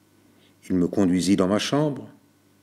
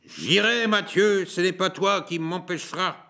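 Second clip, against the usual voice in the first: much higher defiant call; defiant cry; 8 semitones higher than usual. A narrator's reading. Jean defying Mathieu, crying out high. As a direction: throw the defiance back at him. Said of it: "Jean se mit à crier".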